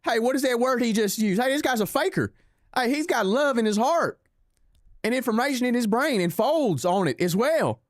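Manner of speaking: high mock voice of confused far-right followers; high whiny mob parody; mocking angry crowd. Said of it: "the people would be like".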